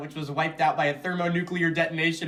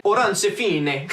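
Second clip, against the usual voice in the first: slow, higher Italian-style name; hammed-up Italian pronunciation; mock Italian accent; 4 semitones higher, slower than usual. Shown as a demonstration. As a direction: draw the name out with Italian vowels. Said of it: "the Italian hand gesture carried over".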